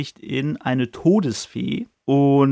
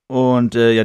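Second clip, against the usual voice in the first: ordinary delivery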